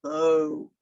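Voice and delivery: deep low voice. Shown as a demonstration. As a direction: drop the voice way down. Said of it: "one named Low".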